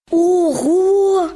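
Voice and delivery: a big astonished sigh; sighing wow. A narrator's reading. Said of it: "зітхнув зайчик".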